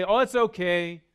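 high, loud, breezy dismissal; dismissive brush-off. Pretend. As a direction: wave it off like nothing happened. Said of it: "You don't say".

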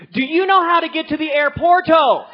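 mock loud tourist shout; loud slow tourist English. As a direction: yell it like they're deaf. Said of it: "What are you doing".

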